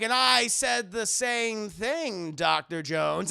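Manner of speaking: slow, stretched dramatic villain voice; villain reveal. Retold or imitated. Villain reveals himself to Indy, slow theatrical voice. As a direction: draw it out for the big reveal.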